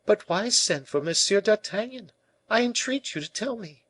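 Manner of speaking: high-pitched urgent plea; exclaimed entreaty. A narrator's reading. La Valliere entreats the king to explain, exclaiming high. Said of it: "exclaimed La Valliere".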